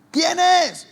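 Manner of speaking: angry mother yelling again; shouted demand. Retold or imitated. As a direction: bark the question out.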